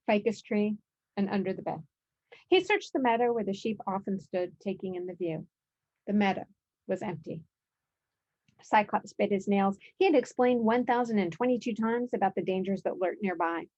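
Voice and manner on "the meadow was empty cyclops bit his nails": ordinary delivery, nothing marked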